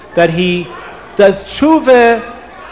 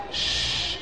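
soft unvoiced shh to hush the room; shushing; 15 dB quieter than usual. Real, not acted. Storyteller hushing the children before the book's words, soft shh.

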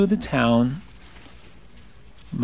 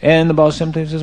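plain reading voice

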